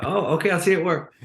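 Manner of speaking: high, fast, mock-polite brush-off; awkward goodbye. Leaving Farley with the strangers, high fast mock-polite farewell. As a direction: back out politely before he drags you in. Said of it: "I'm like".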